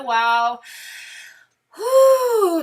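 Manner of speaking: loud, thrilled wow and whew up high; real rush of excitement over the cards; breathless delight; excited gasp. Real, not acted.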